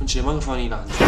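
ordinary talk, nothing marked

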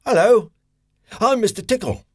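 high cheery character hello; Mister Tickle greeting. A narrator's reading. Narrator greets the listener as Mister Tickle, high and cheery. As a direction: announce yourself brightly.

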